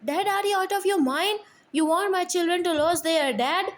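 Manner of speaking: high, fast, loud angry yell. Acted. Son yelling at his father to move, loud and fast. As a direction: snap at his father in disbelief. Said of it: "Jack yelled".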